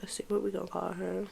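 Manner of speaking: whispered aside while thinking; hushed thinking aloud; muttered whisper; whisper to self. Real, not acted. Thinking of a name.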